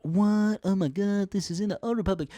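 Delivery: high, loud gushing wonder; mock amazed kids. Kids discovering an ancient crew, high mock amazement. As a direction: play starstruck kids.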